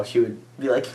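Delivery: ordinary talk, nothing marked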